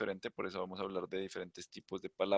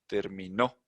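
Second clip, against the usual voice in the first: "Terminó" rising onto a stressed "nó"; stress on final syllable; slower than usual. Shown as a demonstration. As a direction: lean on the last syllable of "Terminó". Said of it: "Y sube acá".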